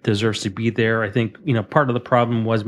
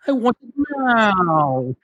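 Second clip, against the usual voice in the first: much higher, slow whiny brat; spoiled brat voice; 11 semitones higher, slower than usual. Retold or imitated. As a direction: drag it out like a spoiled child. Imitating spoiled Veruca Salt.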